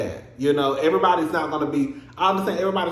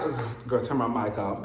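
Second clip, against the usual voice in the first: lower, softer mock of a calm Jen; imitating Jen calmly; 5 semitones lower, 6 dB quieter than usual. Mocking Jen's calm phone reaction, lower and softer. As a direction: quietly play her cool reaction. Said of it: "I won't be like".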